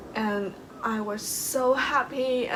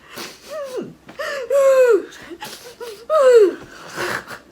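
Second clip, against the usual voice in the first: crying laugh; 12 dB louder than usual. Real, not acted.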